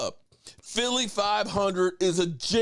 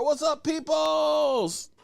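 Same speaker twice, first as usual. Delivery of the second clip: hype greeting; 8 semitones higher, much slower than usual. Real, not acted. Calling out to a viewer.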